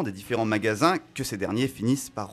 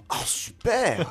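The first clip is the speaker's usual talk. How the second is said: sarcastic enthusiasm; 7 semitones higher, much slower than usual. Pretend. Mock enthusiastic reply.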